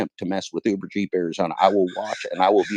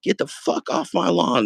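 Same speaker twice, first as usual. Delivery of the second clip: higher, slow mock get-off-my-lawn; mock old-man yell; 11 semitones higher, slower than usual. Old man hollering at kids on his lawn, mock cranky. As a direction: play the cranky old neighbour, pitched up and slow. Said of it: "You go like".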